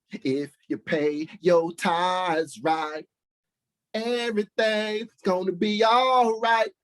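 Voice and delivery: high, hammed-up greedy preacher voice; mock preacher. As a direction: ham up the tithe-begging pastor. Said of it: "Pulpit pimping".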